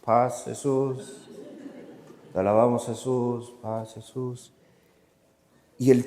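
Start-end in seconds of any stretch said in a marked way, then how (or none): 0.0-4.5 lower, softer reluctant chant with a laugh; half-hearted joining in. Retold or imitated. Narrator joins the prayer, reluctant low chant. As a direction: mumble along unconvinced. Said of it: "Y yo".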